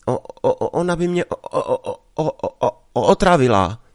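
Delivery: put-on a frightened boy stuck on every syllable; scared stutter. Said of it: "čím dál tím víc ustrašený".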